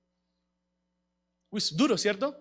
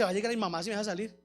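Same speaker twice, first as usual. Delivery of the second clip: rushed message; 5 semitones lower, 12 dB quieter, much faster than usual. Retold or imitated. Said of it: "le dice".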